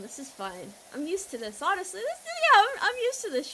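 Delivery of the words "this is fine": softer and lower, a mock-resigned calm; forced calm. Shrugging off chat's insult, low and much softer. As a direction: hush it down, pretending it doesn't bother you. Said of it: "I'm calm".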